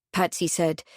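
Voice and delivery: ordinary delivery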